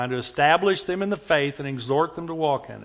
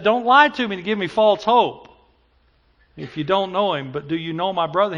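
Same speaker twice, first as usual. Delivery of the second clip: louder, lightly acted plea from the back; pleading voice; 5 semitones higher, 7 dB louder than usual. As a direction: imitate a desperate man calling out. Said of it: "the voice came back and said".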